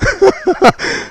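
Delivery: soft, high chuckle; amused laugh. Real, not acted. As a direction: break up at your own parody. Laughs at own hymn parody.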